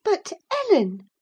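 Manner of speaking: put-on high, loud girlish protest; astonished cry. Girl cries to her housekeeper, high and astonished. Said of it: "staring fixed in astonishment".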